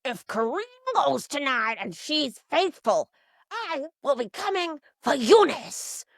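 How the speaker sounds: mock-menacing vow, building to a drawn-out insult; Draco, threatening. Circus freak threatening Eunice over the vote, hammed-up accusation. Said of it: "Circus freak Draco".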